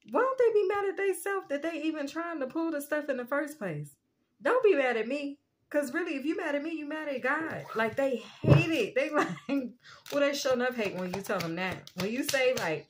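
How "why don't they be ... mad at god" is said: higher-pitched, exasperated complaint; indignant protest. Retold or imitated. Reader complains to God that they blame her, indignant. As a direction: plead your case, put out at the blame.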